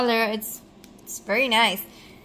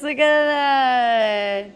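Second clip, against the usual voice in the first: ordinary delivery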